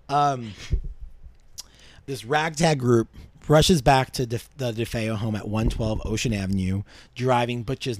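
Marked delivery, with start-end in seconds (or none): none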